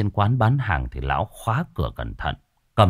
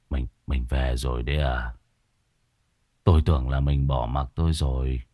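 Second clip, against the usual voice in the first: weak, broken murmur; 7 semitones lower than usual. Acted. Drunken husband to the wife who returned, weak broken voice. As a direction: speak low and feebly, with a small stumble.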